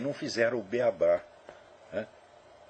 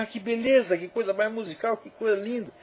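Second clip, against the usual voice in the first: high-pitched mock-enraptured reader; gushing admiration; 7 semitones higher than usual. Imagined reader praising the music of the prose, gushing. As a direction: coo over the beauty in a raised voice.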